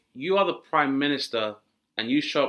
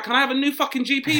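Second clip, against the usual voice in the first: exasperated patient; 11 semitones higher, 5 dB louder, faster than usual. Retold or imitated.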